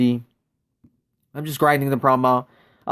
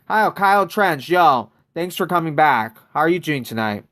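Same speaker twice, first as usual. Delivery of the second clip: usual speaking voice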